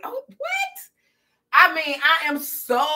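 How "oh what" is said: much higher, squeaky mock shock; shocked squeal. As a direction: squeal it out in disbelief. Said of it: "I was like".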